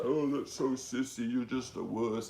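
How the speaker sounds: lightly voiced scoffing tough guy; mocking macho guy. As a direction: sneer it like a dismissive macho guy. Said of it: "Some guys would be like".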